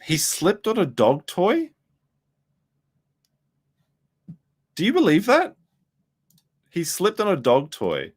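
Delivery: high, drawn-out incredulity; mock disbelief. Real, not acted.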